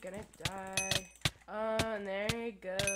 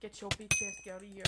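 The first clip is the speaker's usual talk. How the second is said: usual speaking voice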